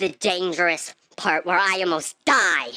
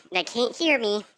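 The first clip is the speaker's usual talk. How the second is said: ordinary talk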